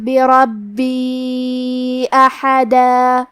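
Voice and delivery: slow, melodic tajweed recitation; measured Quranic chant. Acted. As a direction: chant each verse slowly and evenly. Teacher recites Quran verses.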